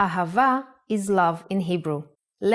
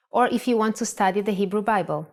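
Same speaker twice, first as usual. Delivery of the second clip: ordinary delivery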